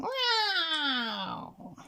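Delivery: high, drawn-out falling cat wail; cat yowl. A narrator's reading. Scarface Claw in the tree, a long high yowl. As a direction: wail it like a cornered tomcat. The cat's yowl echoes around.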